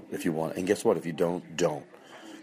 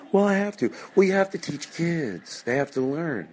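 mock justification; 9 semitones higher than usual.

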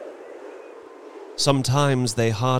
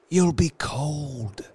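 slow, higher, gently concerned voice; tender; 7 semitones higher, slower than usual. A narrator's reading. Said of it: "he said tenderly".

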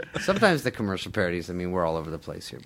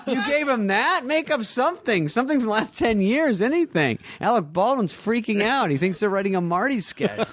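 much higher, laughing exasperated disbelief; mock scold her for feeding the bad idea; incredulous scolding; 13 semitones higher than usual. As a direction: speaker to Lauren after the pitch, incredulous. Scolding Lauren after the meeting.

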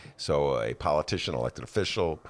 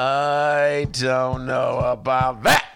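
doubtful; 7 semitones higher, 7 dB louder, much slower than usual. Pretend. Doubtful drawn-out remark.

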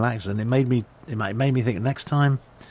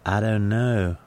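put-on Carolina accent; slower than usual. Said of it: "there's a kind of sort of".

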